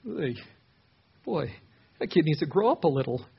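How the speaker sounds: incredulous chuckle through the words; half-laugh it, as if amused by a foolish child; giggling under breath. Acted. Grown adult scoffing at a toddler's threat, giggling under breath. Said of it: "almost giggle under your breath".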